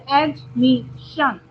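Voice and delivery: Admission with two clear breaks; syllable by syllable. Shown as a demonstration. Teacher showing where to break words, admission split by syllable. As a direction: say admission as three separate syllables. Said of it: "दो बार break करना हो रहा है".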